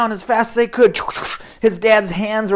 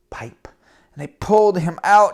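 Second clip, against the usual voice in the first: normal talking voice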